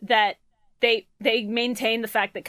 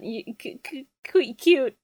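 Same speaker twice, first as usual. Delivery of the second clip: stammered, retold childhood crush; stammering gush; 3 semitones higher, slower than usual. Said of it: "and I went".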